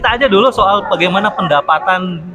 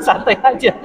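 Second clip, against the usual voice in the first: laughing; 6 semitones higher than usual. Real, not acted. Host laughs at guest smoking.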